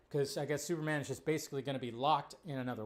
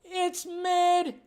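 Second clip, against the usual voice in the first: much higher, louder heckling shout; mock yell; 13 semitones higher, 7 dB louder, much slower than usual. Audience member heckling after the screening, mock yell. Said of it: "yelled out".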